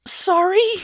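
high, squeaked apology with a rise; sheepish apology. A narrator's reading. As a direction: squeak it out as a question. Startled girl apologizes after kicking him.

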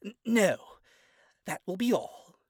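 stammered, sharp and loud; flustered retort. Acted. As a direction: snap it out, flustered. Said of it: "she retorts".

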